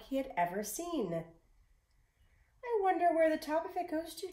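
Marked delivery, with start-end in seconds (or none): 2.6-4.3 put-on higher, quicker boy's voice, curious; a young lad musing aloud to himself; Jack wondering. A narrator's reading. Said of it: "Jack said to himself".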